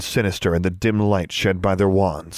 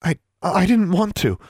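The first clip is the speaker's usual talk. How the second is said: nervous, higher-pitched stammer; 8 semitones higher than usual. A narrator's reading. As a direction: stumble on the first word, cowed. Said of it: "Darla opened her mouth and stammered".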